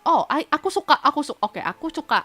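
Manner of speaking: ordinary delivery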